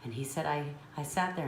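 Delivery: usual speaking voice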